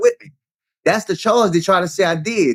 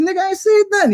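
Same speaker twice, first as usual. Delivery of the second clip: much higher, baffled denial; incredulous denial; faster than usual. Retold or imitated. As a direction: push back in disbelief. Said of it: "I said".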